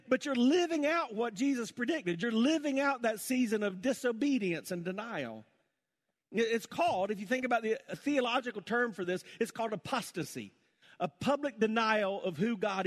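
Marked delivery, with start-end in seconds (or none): 0.1-7.8 raised, forceful preacher's voice; fired-up preaching. Acted. Preacher warning believers about passion and denial, fired-up preaching. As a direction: press it on the congregation.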